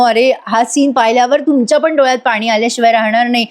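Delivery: ordinary delivery, nothing marked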